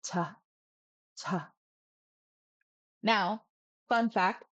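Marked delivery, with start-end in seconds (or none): 0.0-1.5 "cha" low and soft, with a light puff; softer low-tone "cha"; low tone, mid aspiration. Shown as a demonstration. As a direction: drop "cha" low, moderate breath on ch. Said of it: "low tone and just right".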